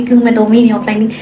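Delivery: ordinary delivery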